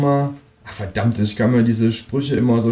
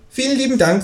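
much higher cheeky kangaroo voice. Acted. Kangaroo asking the narrator for eggs, much higher voice. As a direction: play the new neighbour kangaroo, pitched far up. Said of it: "sagt das Känguru".

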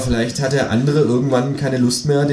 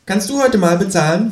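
higher, cheeky kangaroo asking; 8 semitones higher than usual. Acted. Kangaroo asking the narrator to pay after dinner, cheeky higher voice. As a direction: nudge him to pay, sly and high. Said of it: "fragt das Känguru nach dem Essen".

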